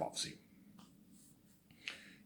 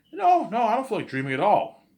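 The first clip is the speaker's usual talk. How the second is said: emphatic denial; 13 semitones higher, 8 dB louder than usual. Acted. Guy in the story flatly denying.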